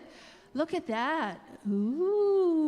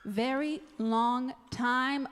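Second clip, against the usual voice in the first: singsong puppet show host. Acted. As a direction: stretch it out like storytime. Storytelling to children, drawn out.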